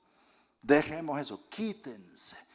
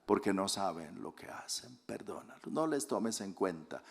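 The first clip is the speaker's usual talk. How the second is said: 1 tender prayer; 5 semitones lower, 7 dB quieter than usual. Acted. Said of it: "Él dijo".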